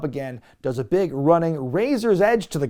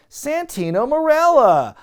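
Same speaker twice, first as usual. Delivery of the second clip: mock unison blurt; 11 semitones higher, 7 dB louder, slower than usual. Bellas blurt out his name.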